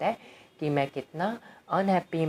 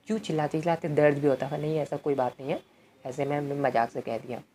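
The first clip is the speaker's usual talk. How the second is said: ordinary talk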